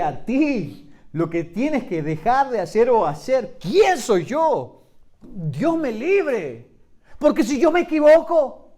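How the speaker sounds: loud, rising sermon delivery with slow, drawn-out peaks; full-force preaching, stretching the key lines; fiery preaching. Acted. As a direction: preach it out to the congregation.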